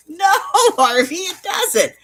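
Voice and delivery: high, drawn-out, giggly objection; exasperated laughing protest. Retold or imitated. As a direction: scold the agent while cracking up. Laughing argument with Harvey.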